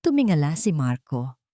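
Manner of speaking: usual speaking voice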